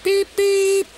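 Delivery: two very high, loud toots; imitated high "beep, beep" whistle; train whistle beeps. A narrator's reading. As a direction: pipe out a piercing engine whistle. Narrator imitates engine whistle.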